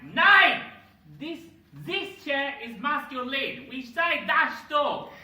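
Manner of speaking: put-on loud, high, emphatic German accent; shouted mock-German, slow and forceful. Comedian playing the German insisting the chair is masculine, shouted German accent. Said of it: "And the German goes like".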